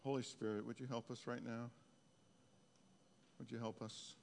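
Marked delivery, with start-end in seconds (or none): none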